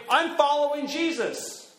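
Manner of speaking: high, drawn-out declaration; bold claim. Acted. People to come professing their faith, boldly declaring. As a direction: proclaim it slowly and proudly. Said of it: "who say".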